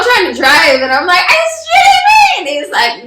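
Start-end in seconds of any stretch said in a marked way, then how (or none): 1.2-2.2 very high-pitched retold shriek; freaked-out disbelief. Freaking-out driver challenging the passenger while trying to drive. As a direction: squeal it in incredulous alarm. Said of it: "So I'm freaking out".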